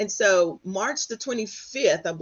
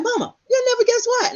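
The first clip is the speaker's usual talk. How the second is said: excited news; 4 dB louder than usual. Retold or imitated. Calling mom with big news.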